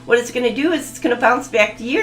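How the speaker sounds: ordinary talk, nothing marked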